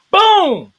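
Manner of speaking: sudden high, loud burst; boom. Acted. Host imitating the Rocky Four intro, sudden loud boom. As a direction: slam the word like a punch landing.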